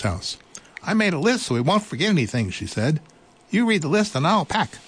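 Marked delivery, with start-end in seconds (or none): 0.8-2.5 high and quick mouse voice; busy little mouse. Acted. 3.5-4.8 high, bright little mouse voice; eager little mouse. Acted.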